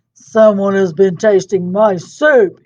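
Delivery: Papa Bear booming over his soup; roaring Papa Bear. Acted. Papa Bear finds spilled soup, mock roar. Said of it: "he roared".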